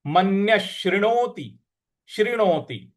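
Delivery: शृणोति said slowly syllable by syllable; slow careful. Shown as a demonstration. Teacher slowing down शृणोति for students. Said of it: "त पर छोटी की मात्रा है".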